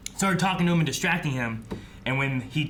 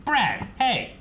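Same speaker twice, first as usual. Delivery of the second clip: slow, drawn-out mock reprimand, pitched up; unfazed scolding; 6 semitones higher, much slower than usual. Imitating a co-star walking in on the chaos, unfazed slow scolding. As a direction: space out each word, higher, as a catchphrase. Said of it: "without missing a beat".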